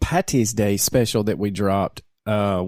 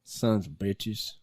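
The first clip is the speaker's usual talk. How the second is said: muttered curse; 4 semitones lower, 12 dB quieter, slower than usual. Real, not acted. Cursing the vanished page.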